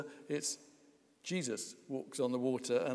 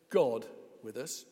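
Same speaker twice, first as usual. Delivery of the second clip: "God" hit hardest, rising; stress on GOD; 2 semitones lower than usual. Shown as a demonstration. Preacher showing the congregation which word to stress, stress on God. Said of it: "if you stress the word".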